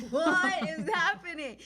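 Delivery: high, loud, slow cry of bewilderment; re-enacted freak-out. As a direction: yell it out, drawn out, for comic retelling. Acts out screaming at sister's.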